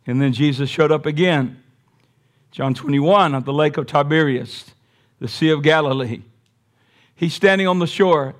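normal talking voice

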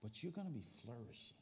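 quiet, low pastoral plea; soft earnest appeal. Acted. Pastor asking the church to commit, soft and low. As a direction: plead gently and quietly.

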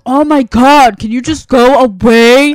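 loud, slow, fed-up groan of a reply; exasperated brush-off. Retold or imitated. Lloyd brushing off the kid who keeps talking to him, loud and fed up. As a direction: push the pest away, sick of him. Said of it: "Lloyd's like".

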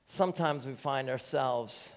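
ordinary talk, nothing marked

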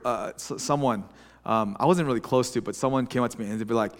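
ordinary talk

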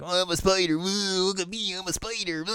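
high, teasing sing-song; mock spider taunt. Mocking Shiva's spider taunt.

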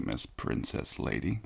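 usual speaking voice

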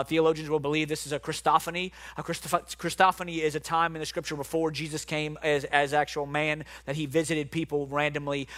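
normal talking voice

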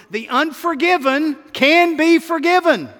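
"the unforgiven can be forgiven" shouted in triumph, "be" hammered; 1 shouted refrain. Shown as a demonstration. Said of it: "Yes, Lord, yes".

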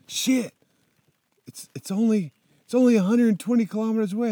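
high, quick, excited exclaim; excited relief. Retold or imitated. Rider pleased at the distance left, excited. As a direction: blurt it out, pleased.

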